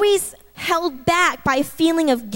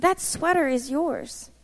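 softer, gentle divine voice; quietly reassuring, imitating God; still small voice; 6 dB quieter than usual. The Lord telling a shopper the sweater is hers, quietly. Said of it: "this still small voice of the Lord".

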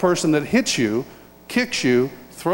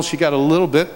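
normal talking voice

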